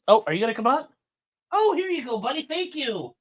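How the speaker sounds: high, sing-song pet talk; coaxing the dog. Real, not acted. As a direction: coax the dog up, sweet and high. Dog comes, praising him.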